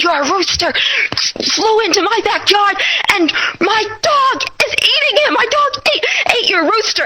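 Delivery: replaying her own tearful panic at the neighbor's door; hysterical. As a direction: voice way up and breaking, frantic. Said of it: "I'm, like, all hysterical".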